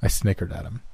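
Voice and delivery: plain reading voice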